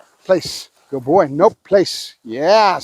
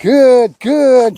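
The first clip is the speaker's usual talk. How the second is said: "Good, good" higher, each drawn out and falling; accented marker; 4 semitones higher, 4 dB louder than usual. Real, not acted. Trainer marking the dog's success, accented, drawn-out "Good, good". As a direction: lean long on each "Good". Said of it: "Trying to accent my markers".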